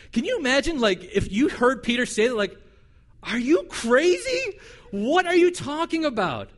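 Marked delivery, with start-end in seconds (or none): 3.2-6.5 incredulous. Acted. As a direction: stretch out the disbelief. Reacting to Peter's request.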